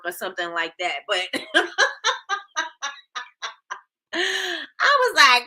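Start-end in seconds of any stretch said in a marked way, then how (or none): 1.3-5.5 slow, high words stretched by laughter; laughing. Real, not acted. Laughing while retelling.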